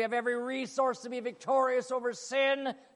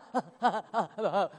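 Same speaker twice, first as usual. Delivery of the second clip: loud startled laugh; nervous laugh; 4 dB louder than usual. Acted. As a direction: laugh in flustered shock. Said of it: "Zacchaeus goes".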